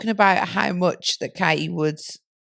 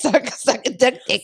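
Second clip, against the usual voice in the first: giggling; 6 semitones higher than usual. Real, not acted. Giggling repetition.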